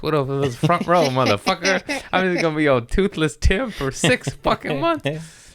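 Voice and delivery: high-pitched comic outrage; indignant protest. Retold or imitated. Patient facing six months toothless, indignant comic rant. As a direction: replay a worked-up protest at the dentist.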